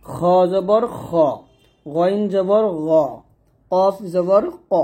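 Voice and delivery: slow spelling, খ, গ, ক heavy and rounded; heavy Arabic letters. Shown as a demonstration. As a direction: draw out each খ, গ, ক as kho, gho, qo. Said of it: "এরকমভাবে আমাদেরকে ... মোটা করে". (খ, গ, ক মোটা করে, ধীরে টেনে)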